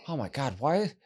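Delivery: usual speaking voice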